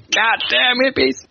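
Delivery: hammed-up yell at hippies; quoting a film clerk's outburst at hippies; mock outraged yell. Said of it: "car rental agent's like".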